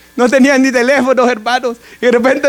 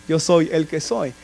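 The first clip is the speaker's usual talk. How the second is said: hushed and lower, a little slow; soft low quote; 5 semitones lower, 7 dB quieter than usual. Acted. Preacher quietly restating God's name to the congregation, hushed. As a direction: say it softly as a reverent aside.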